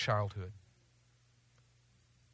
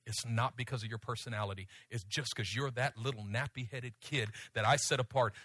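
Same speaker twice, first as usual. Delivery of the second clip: soft, hushed voice of God, much softer; God's whisper; 7 semitones lower, 6 dB quieter than usual. Retold or imitated. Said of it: "whispered this in my ear".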